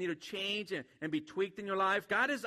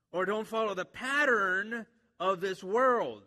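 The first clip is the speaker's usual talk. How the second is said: normal talking voice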